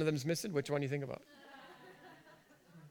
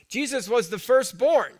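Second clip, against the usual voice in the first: higher, louder proclamation; preaching shout; 7 semitones higher, 8 dB louder than usual. Real, not acted. Preacher driving home his point to the congregation, shouting. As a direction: belt it out to the room.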